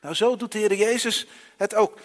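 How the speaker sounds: normal talking voice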